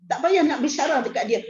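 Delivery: ordinary talk, nothing marked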